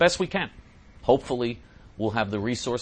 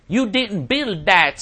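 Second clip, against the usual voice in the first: higher, slow, drawn-out mock quote; mock Obama quote; 9 semitones higher, 9 dB louder, much slower than usual. Host reading a listener's question quotes the speech, mock Obama. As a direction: lightly mimic a speech line.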